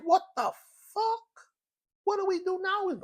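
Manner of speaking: high stunned what-the-hell; stunned disbelief. Retold or imitated. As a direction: squeak out your disbelief at the slammed door. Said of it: "I'm like".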